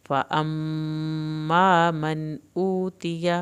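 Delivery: "فَأَمَّا" with doubled meem, slow; slow recitation demo; meem shadda. Shown as a demonstration. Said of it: "میم کی شد پڑھی جائے گی".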